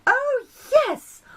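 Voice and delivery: light up with sudden delight. Acted. The Sun remembers, clapping, bright and excited. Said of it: "The sun clapped his hands together".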